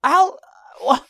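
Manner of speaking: very high, strangled stammering; splutter, too incensed to finish; sputtering outrage. Retold or imitated. Acting out his speechless escalating outrage.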